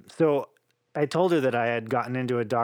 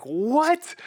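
very high, louder astonished "What?"; shocked dad; 12 semitones higher, 4 dB louder than usual. Retold or imitated. Dad on the phone, stunned by the salary, astonished high yelp. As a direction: leap way up in disbelief.

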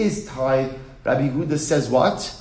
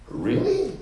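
mock incredulous; 10 semitones higher than usual. Challenging Rabbi Yehuda's ruling.

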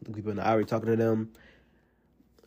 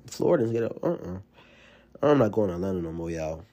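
normal talking voice

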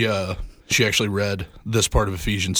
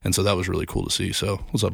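ordinary talk

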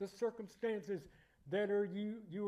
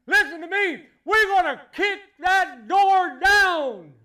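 loud, high, slow preacher's battle cry; defiant shout; 9 semitones higher, 17 dB louder, slower than usual. Acted. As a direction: roar it back at the enemy. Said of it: "people at Mission Church that says".

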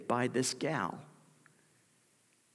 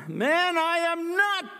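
very high, loud, slow protest; indignant denial; 7 dB louder, slower than usual. Acted. Peter denying to a bystander, high loud indignant. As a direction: throw the denial back hard.

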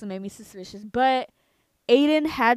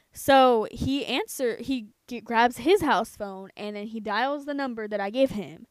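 ordinary talk, nothing marked

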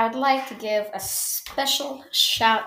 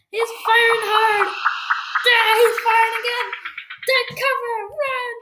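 play panic shouting; 11 semitones higher than usual. Pretend. Podcaster under pretend fire, high panicked shouts. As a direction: yell over gunfire in pretend panic.

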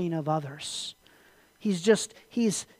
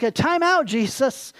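high, loud mock protest; alarmed objection; 6 semitones higher, 5 dB louder than usual. Disciples object to Jesus going to Jerusalem, alarmed protest. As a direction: cut in with a comic, high-pitched objection.

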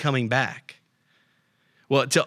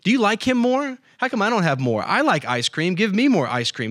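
put-on high, petulant kid whining for more; childish whine, pitched up; whiny sibling; 7 semitones higher than usual. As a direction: play the jealous little brother begging. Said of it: "the sibling that looks over at their brother or sister and goes".

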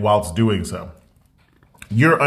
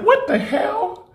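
much higher, slow and drawn out, laughing disbelief; shocked outcry; 14 semitones higher, much slower than usual. Retold or imitated. Retelling to sister about stretchy jeans, high laughing outcry. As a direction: squeal it in laughing outrage. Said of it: "I was like".

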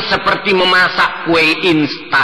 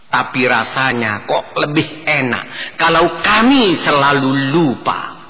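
usual speaking voice

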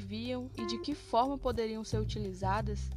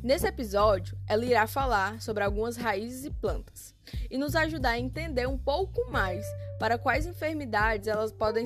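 put-on kids show host; 7 dB louder than usual. Podcast host intro over music.

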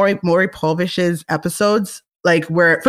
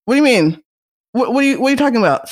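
incredulous retort; 4 semitones higher, faster than usual. Retold or imitated. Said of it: "I was just like".

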